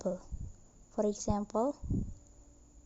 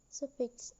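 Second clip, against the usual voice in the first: normal talking voice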